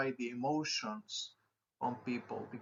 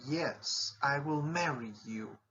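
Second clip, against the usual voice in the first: joyful exclamation. Shown as a demonstration. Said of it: "I will provide you some examples".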